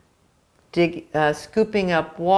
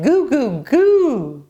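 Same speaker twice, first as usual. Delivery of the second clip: put-on baby babble; 5 semitones higher, 4 dB louder, slower than usual. Baby eats bread, babbles.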